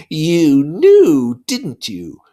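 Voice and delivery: put-on a buzzing devil taunting a child; Lord of the Flies, mocking. Lord of the Flies taunting Simon, slow and sneering.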